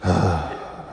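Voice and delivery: heavy mock sigh, let out softly; dejected sigh. Pastor imitating a girl who did wrong, dejected sigh. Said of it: "She has that kind of".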